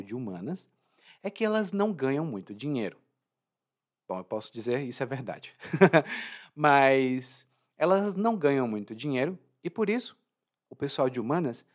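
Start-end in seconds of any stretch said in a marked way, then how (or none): 5.6-6.4 risada curta. Real, not acted. Ri da própria piada.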